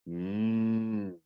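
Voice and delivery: long, steady imitated moo; cow's moo. A narrator's reading. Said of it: "Bessie lowered".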